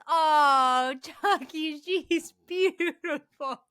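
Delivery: high, loud, slow gushing; gushing Tiffany. Retold or imitated. Tiffany presents the bride doll to Chucky, high slow gush. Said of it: "and says".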